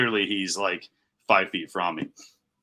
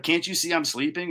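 higher-pitched irritated question; retold snapping; 7 semitones higher than usual. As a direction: act out an exasperated complaint. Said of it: "I snap a couple times".

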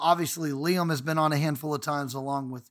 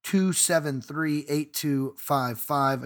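ordinary talk, nothing marked

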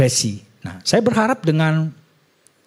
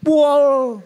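much higher whooping fan cries; mock football yelling; 13 semitones higher, much slower than usual. Men watching football, yelling high and excited. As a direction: cheer like a guy at a football match. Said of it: "teriak-teriak".

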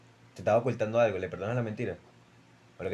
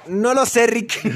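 mock dramatic quote; 10 semitones higher, 9 dB louder, much slower than usual. Jokey meme quote mid-debate.

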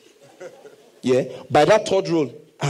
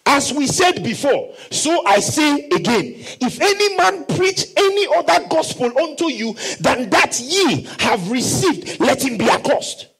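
shouted scripture; 7 semitones higher than usual. Acted. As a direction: proclaim the verse with force. Reads verse nine.

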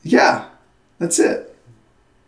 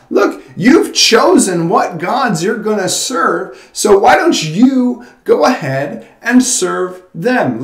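God's rebuke; 10 semitones higher, 7 dB louder than usual. Retold or imitated. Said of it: "where God says".

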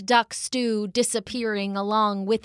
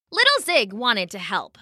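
usual speaking voice

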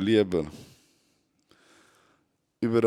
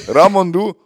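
ordinary talk, nothing marked

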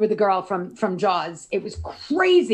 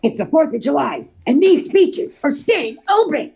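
imitating the mayor; 5 semitones higher, 5 dB louder than usual. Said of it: "That's exactly what it sounded like".

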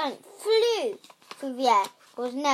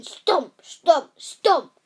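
heavy booming stomps, building louder; stomping footsteps; 4 semitones higher, 10 dB louder, much slower than usual. A narrator's reading. Narrator booming the giant's footsteps coming closer. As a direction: pound out each stomp like giant feet.

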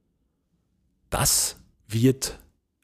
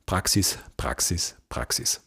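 each repeat lower and softer; repeated for emphasis; 4 semitones lower, 3 dB quieter than usual. Shown as a demonstration. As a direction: hammer the word Praxis home, letting it sink lower and softer each time. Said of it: "Was ist das Allerwichtigste?".

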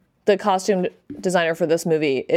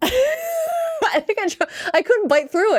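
laughing; 13 semitones higher than usual. Real, not acted. Laughing at salmon memory.

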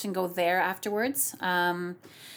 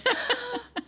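high mock-thrilled gasp; gushing gasp; 13 semitones higher than usual. Singer delighted at being recognized, gushing gasp. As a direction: gasp as if utterly flattered. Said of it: "like, gushing".

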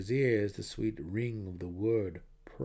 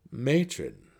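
stuttering; 5 semitones higher, 6 dB louder than usual. A narrator's reading. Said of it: "Jarlaxle stuttered".

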